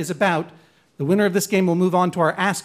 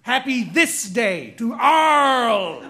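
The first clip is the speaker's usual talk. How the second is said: aggressive pirate. Acted. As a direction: threaten the crew like a hammy pirate, slowed down. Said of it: "amazing and aggressive pirate".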